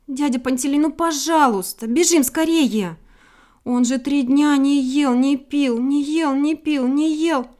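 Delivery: high, loud, insistent little bird; acted breathless-paced pleading; urgent begging. A narrator's reading. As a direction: beg without letting up, piling phrase on phrase. Bird begs dog to hurry.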